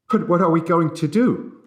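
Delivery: high, loud frightened plea; panicked question. A narrator's reading. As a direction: beg for an answer in rising panic. Panicked passenger after derailment.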